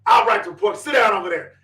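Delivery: loud, high mock-sergeant orders; retold yelling. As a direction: bark orders at a mother, imitating. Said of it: "Now she's yelling at the mother".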